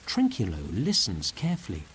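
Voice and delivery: usual speaking voice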